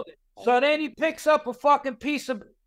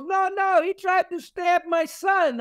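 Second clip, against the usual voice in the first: put-on much higher, protesting old lady; old Bulgarian lady; 8 semitones higher than usual. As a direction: defend her son to the cops, pitched way up. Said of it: "she's this old Bulgarian lady".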